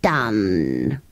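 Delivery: very low, long-drawn word; spent creature. Acted. Creature granting another wish, low and drained. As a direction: sink deep as you stretch it.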